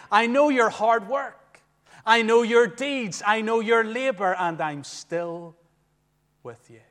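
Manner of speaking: slow, earnest promise that fades to a hush; slow preacher's voice of Christ, trailing off; voicing Christ. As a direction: speak as Christ, steady and sure, then soften.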